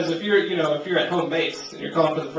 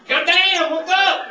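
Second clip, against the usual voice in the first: high, loud startled Jesus; 10 semitones higher, 5 dB louder, slower than usual. Acted. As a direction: yelp it at the fried apostles. Jesus voiced in a bit.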